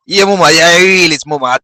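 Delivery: loud excited cheering. Real, not acted. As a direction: hype it up loud. Cheering the fire in the streets.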